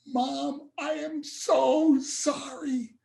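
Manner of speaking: high, very slow, aching plea to the sky; anguished apology. Retold or imitated. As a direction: reach up as if your heart breaks. Crying out to heaven after loss.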